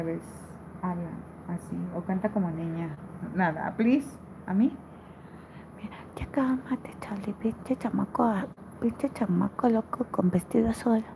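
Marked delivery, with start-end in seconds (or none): 5.8-11.0 teasing whisper; playful whisper. Acted. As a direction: whisper it like a joking secret. Teasing a viewer in chat.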